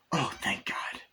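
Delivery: soft, whispered sigh of relief; relieved whisper. Retold or imitated. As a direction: breathe it out, relieved, as Chucky. Chucky relieved it's a boy.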